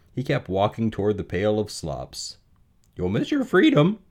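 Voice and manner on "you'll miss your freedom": high, louder goose honk; high-pitched goose plea. Acted. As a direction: honk a warning in a bird voice. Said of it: "honked the goose".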